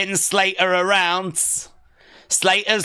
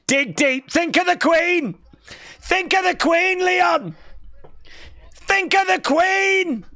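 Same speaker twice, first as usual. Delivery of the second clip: high shouted chant of encouragement; will him on from ringside; excited cheering; 12 semitones higher than usual. Real, not acted. Cheering wrestler on.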